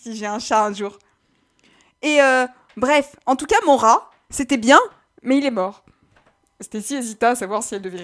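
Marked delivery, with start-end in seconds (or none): 2.0-5.8 voix de personnage. Acted. Personnage raconte la mort du rat.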